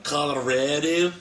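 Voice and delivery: put-on unconvincing regional Colorado accent; attempted Colorado accent. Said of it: "That's not a Colorado accent at all".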